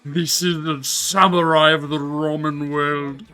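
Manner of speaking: loud, grand ramen critic verdict; rapturous tasting food, savouring each word. Pretend. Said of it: "[name] food critic voice activate".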